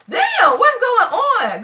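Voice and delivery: mock exclaim. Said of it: "we over here talking about".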